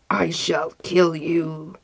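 slow, deliberate death threat; menacing genius. A narrator's reading. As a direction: pronounce doom slowly as the enraged genie. Enraged genius threatens the merchant.

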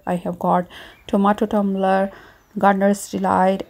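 ordinary delivery

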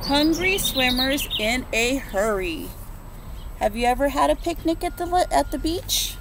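ordinary delivery, nothing marked

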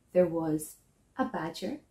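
ordinary delivery

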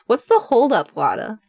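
usual speaking voice

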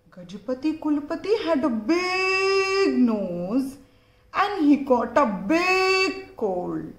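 slow, drawn-out storybook emphasis; reading to children. A narrator's reading. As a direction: stretch each word for little listeners.

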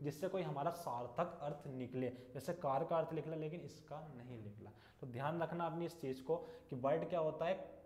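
usual speaking voice

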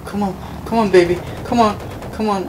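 high pleading come on; urging on. Real, not acted. Player urging the game on, eager pleading. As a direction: coax the game along, pitch up.

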